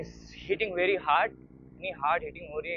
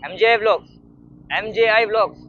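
loud, raised call of the vlog name; channel shout-out; 3 semitones higher, 9 dB louder than usual. Pretend. Vlogger calls out the channel name, loud shout-out. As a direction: belt out the channel name like a slogan.

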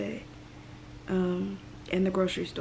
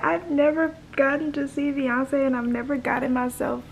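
crying; 5 semitones higher than usual. Real, not acted. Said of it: "Why am I crying?".

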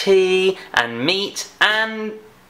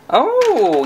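surprised oh; 8 semitones higher, 5 dB louder than usual. Pretend. Teacher surprised at the find.

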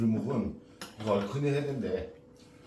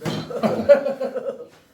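loud laugh; 13 semitones higher, 10 dB louder than usual. Real, not acted. Laughing at emptied bowl.

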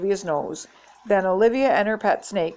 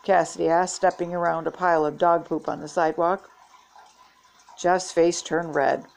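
plain reading voice

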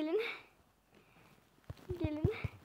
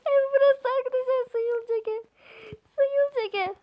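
playful silly voice; 8 semitones higher, 4 dB louder than usual. Pretend. Playful put-on voice touring house.

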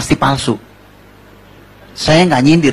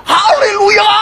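very high, stretched-out scream; panicked shout; 3 dB louder, much slower than usual. Acted. Rider heading for a cliff, screaming to stop. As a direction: scream the stop word to halt the horse. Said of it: "Dia teriak".

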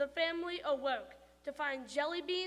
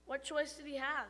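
ordinary delivery, nothing marked

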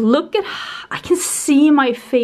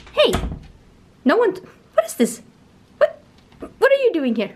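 surprised; 7 semitones higher, faster than usual. Real, not acted. Finds a creature in closet.

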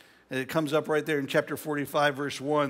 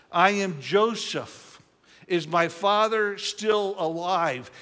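slow dramatic reveal; slower than usual. Shown as a demonstration. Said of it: "retelling the reveal slowly".

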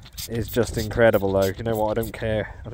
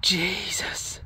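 breathless whispered awe; barely a breath, stunned by the find; awed whisper; 6 semitones higher, 4 dB quieter than usual. Real, not acted. Detectorist staring at his coin, whispering in awe.

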